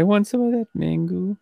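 bad Polish accent. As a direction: attempt a Polish guy and miss. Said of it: "Like some Polish guy".

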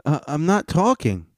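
mock-whiny I'm not talking; mocking the panelist. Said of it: "He goes".

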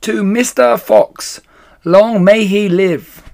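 loud, high, slow group cheer; the animals answering the toast with a loud cheer; shouted toast. Acted. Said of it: "they all shouted".

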